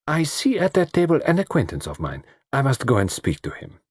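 precise, polite foreign gentleman; Poirot, courteous. Acted.

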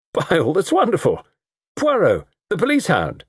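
high-pitched, surprised exclamation; young man's jovial, high-pitched cry; delighted cry. A narrator's reading. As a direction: hail an old acquaintance with theatrical delight. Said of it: "cried the young man".

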